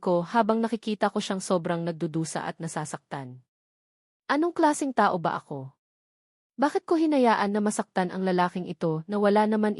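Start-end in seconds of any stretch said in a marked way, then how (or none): none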